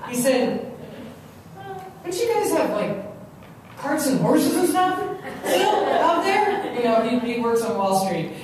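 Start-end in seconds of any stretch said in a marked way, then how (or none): 2.0-6.7 mildly mocking high-pitched question; lightly voiced incredulous big-city brother; imitating his brother. Brother teasing him about living out west, high mock question. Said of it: "my brother said to me".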